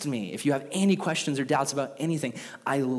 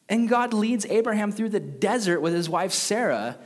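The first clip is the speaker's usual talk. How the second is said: ordinary delivery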